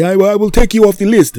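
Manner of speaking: high, loud mock squeal; mocking the guy. Teller mimics the car wash guy, high squeal. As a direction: put words in his mouth, squeaky. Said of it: "be say".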